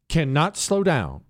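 ordinary talk, nothing marked

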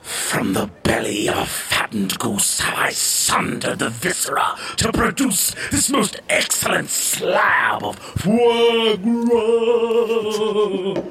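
grandiose chef. Acted. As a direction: declaim it like a proud chef. Grand chef presenting the foie gras.

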